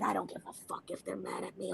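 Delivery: very low, hushed tough talk; tough reassurance. Retold or imitated. Telling the old lady not to worry, low and hushed. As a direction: drop right down, quiet and unbothered.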